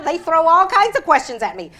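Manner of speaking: normal talking voice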